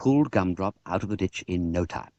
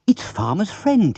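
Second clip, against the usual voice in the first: high, slow glad call; spotting an old friend down the lane; happy recognition; excited cry; 9 semitones higher, 3 dB louder, slower than usual. Acted. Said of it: "he cried".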